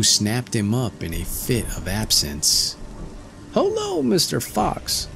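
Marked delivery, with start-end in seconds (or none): 3.5-5.1 very high squeaky call; tiny voice calling out; little tailor crying. A narrator's reading. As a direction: hail the fox from its throat. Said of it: "cried the little tailor".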